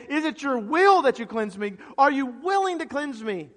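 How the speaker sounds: high, loud, urgent question; impassioned begging in a preacher's voice; imploring. Acted. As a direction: plead fervently for an answer. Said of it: "this word implore means he's asking him".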